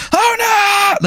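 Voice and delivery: very high, drawn-out mock cry of dismay; hammed-up horrified howl; super upset wail. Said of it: "he's, like, super upset".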